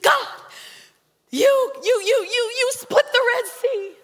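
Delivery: high, stammering plea to God; dramatic pleading prayer. Retold or imitated. Preacher hamming up her plea to God for healing. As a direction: stumble over you in melodramatic begging.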